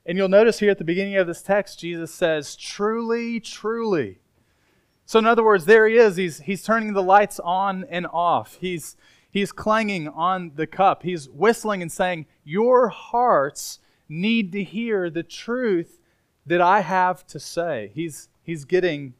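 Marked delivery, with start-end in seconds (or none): 2.6-4.2 "Truly, truly" with each word pitched high and drawn out; emphatic. Retold or imitated. Preacher quoting Jesus to get attention, "Truly, truly" said emphatically. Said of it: "by saying something emphatically". 12.4-17.9 slow, spaced-out emphatic quote. Retold or imitated. Preacher voicing Jesus to get listeners' attention, slow and emphatic. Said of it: "He's speaking emphatically right here".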